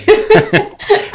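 a real, high burst of laughter. Real, not acted. Guest laughing at her own bestest slip, high laugh. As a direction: laugh at your own made-up word.

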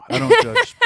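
very high and loud squeak; squeaky mock innocence. Joking disclaimer in squeaky voice.

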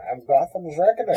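menacing masked villain, lightly done; Bane voice. Pretend. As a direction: speak like a masked supervillain. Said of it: "Bane is here".

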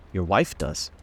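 ordinary delivery

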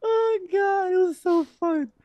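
very high, loud squeal through laughter; real helpless laughter. Real, not acted. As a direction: gasp it out at the top of the voice, cracking up.

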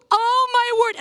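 very high, loud cry of horror; hammed-up horrified shriek for help; retold scream. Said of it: "I scream for my husband".

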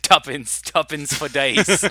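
loud, high Cockney cry; exaggerated Cockney street-seller call; mock Cockney accent. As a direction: call it out in hammed-up Cockney, stretching the end. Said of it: "entire episode in Cockney accents".

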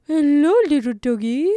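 put-on high, loud cooing baby talk; high sing-song hello to a dog; doggy voice. Shown as a demonstration. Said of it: "Those are my little doggy voices".

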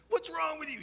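a hammed-up angry parent; mock yell.

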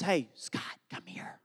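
imitating wife's hushed call; hushed summons. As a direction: call him over under your breath, trailing into a whisper. Wife quietly calls him over.

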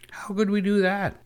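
put-on very high child voice; girl's question. A narrator's reading. Ida asking her cousin about the new idea, high-pitched. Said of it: "asked Ida".

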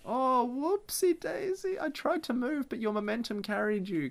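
high, soft sing-song fake apology; feigned innocence. Co-host voicing Zappelli excusing his gut punch, mock-innocent. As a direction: play an obviously phony accidental slip, high and soft.

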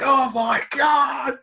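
high, slow, terrified shriek; terrified shout. Retold or imitated. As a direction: shriek it out in terror. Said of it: "and I'm like".

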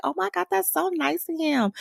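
high, swooning gush; gushing fans. Retold or imitated. Podcaster imitates fans swooning over Fezco, high gush. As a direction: coo like a charmed fan. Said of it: "Everybody was like".

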